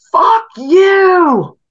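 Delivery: high, loud screamed curse; mock female yell. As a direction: shriek it like an angry neighbor woman. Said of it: "loud female".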